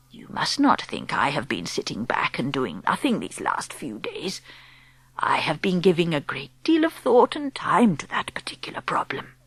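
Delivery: put-on calm whispery old woman explaining; old grandmother. Grandmother speaks.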